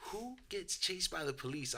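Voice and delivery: breathy whisper of disbelief. Retold or imitated. Retold disbelief.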